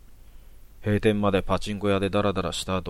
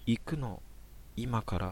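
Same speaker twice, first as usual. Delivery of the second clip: much softer, barely audible murmur; tiny voice; 3 semitones higher, 9 dB quieter, slower than usual. Acted. Girl answers the stranger in a tiny soft voice. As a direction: mumble it downward, almost lost in the rain. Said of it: "めっちゃ小声で".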